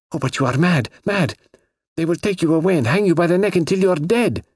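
high, agitated warning; frantic girl. A narrator's reading. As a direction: plead with him in rising alarm. Girl frantically warns he will hang.

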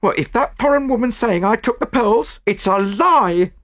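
high, indignant chambermaid voice; heated. A narrator's reading. Chambermaid denies taking the pearls, heated. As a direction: deny it hotly in a high pitch. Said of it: "she declared heatedly".

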